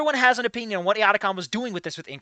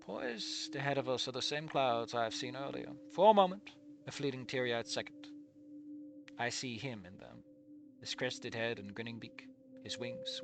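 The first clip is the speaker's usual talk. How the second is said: quiet, even reading of the story text; story narration; 5 semitones lower, 5 dB quieter than usual. A narrator's reading. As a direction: read the passage softly, as the narrator. Reads the game's narration.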